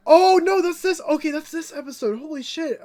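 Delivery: sudden realization. Real, not acted. Host realizing it was this episode, gasping surprise. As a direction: gasp then high, loud surprise.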